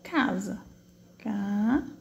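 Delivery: "Casa" as a held CA, pause, SA; split into syllables. Shown as a demonstration. Teacher counting syllables, word pulled apart. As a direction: say "Casa" one syllable at a time. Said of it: "Quantas sílabas eu tenho".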